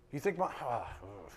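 ordinary talk

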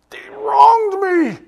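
high, loud, slow wail of grievance; stretched-out mock whine, loud and high; whiny outburst. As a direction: wail it like a wronged child.

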